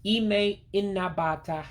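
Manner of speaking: Ime inabata slow and high, as ee-may in-na-ba-tah; every letter pronounced. Shown as a demonstration. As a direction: model Ime inabata with no contraction. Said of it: "you pronounce everything you see there".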